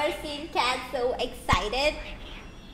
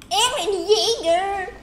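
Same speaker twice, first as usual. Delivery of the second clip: calling out; 7 semitones higher, 5 dB louder, slower than usual. Real, not acted. Calling out to find character.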